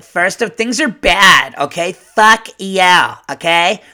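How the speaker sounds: mock a self-righteous complainer, punching the key words; indignant complainer's tone. Shown as a demonstration. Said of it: "their tone is always that same little".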